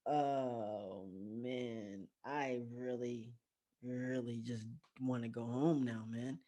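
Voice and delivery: soft, slow, low inner groan; reluctant. Retold or imitated. Storyteller voices his private wish to go home, reluctant. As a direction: drag it out quietly, wishing to escape.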